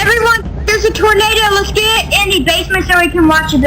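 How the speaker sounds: high, rushed alarm call; excited announcement. Acted. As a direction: call everyone in a hurry. Announcing tornado in game.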